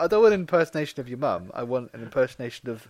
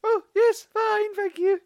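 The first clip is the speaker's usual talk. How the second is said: posh mum voice; slower than usual. Pretend. Voicing a polite mum reply.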